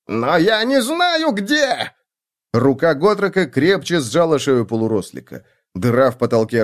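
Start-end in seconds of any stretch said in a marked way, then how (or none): 0.1-1.9 high, frantic little voice; high panicky squeal; squealing halfling. A narrator's reading. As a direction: plead like a terrified halfling.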